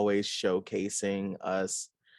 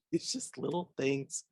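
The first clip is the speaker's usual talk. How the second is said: real giggle into softer, higher words; laughing; 5 semitones higher, 6 dB quieter than usual. Real, not acted. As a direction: talk through an amused laugh. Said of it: "Sorry, I'm laughing".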